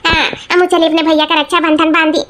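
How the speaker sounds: normal talking voice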